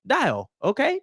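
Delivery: "Dial" for DAO, his own Southern way; mispronounced "dial". Shown as a demonstration. Telling viewers he'll say it his way, "Dial". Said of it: "announce it the best way I can".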